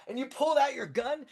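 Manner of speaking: high-pitched, breathless-with-awe retelling; excited fan. Acted. Fan retells the shootout to his hero, excited. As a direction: recount it in a high, thrilled voice.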